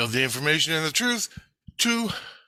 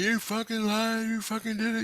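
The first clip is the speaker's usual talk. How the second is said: mock yell; 10 semitones higher, 3 dB quieter than usual. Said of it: "people screaming at me".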